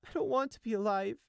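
high, pained little voice; small despairing voice. A narrator's reading. Grieving sheep gives up, small and despairing. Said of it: "he said in a small voice".

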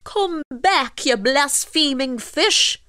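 high, drawn-out indignant call. A narrator's reading. Fisherman calls after the escaping fish, indignant call. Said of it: "called out Michael".

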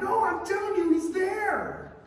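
high-pitched insistent protest; acted insistence. Rhoda answering the doubters, high mock insistence. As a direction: insist that you really saw him. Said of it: "She kept insisting".

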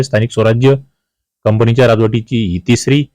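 ordinary delivery

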